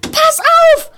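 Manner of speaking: high, loud alarmed shout; screamed warning. A narrator's reading. Girl warns the boy of the dragon, screaming. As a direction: shriek a sudden warning. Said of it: "schrie Emily".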